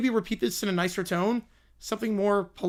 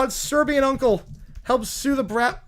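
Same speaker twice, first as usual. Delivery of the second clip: usual speaking voice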